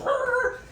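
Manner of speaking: a high, hammed-up snarl of rage; angry growl. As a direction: snarl like a maddened dragon man. Enraged boss growls.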